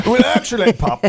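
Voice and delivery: high, affected epicure; hammed-up pompous diner cut short; put-on indulgent voice. Playing along as the grape is popped in, affected. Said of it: "start talking in that voice".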